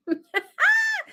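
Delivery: real high-pitched burst of laughter. Real, not acted. Laughs after moisturized remark.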